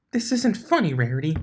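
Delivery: high, loud protest; exclaiming. Acted. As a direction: cry out in indignant protest. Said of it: "the drugged unicorn exclaimed".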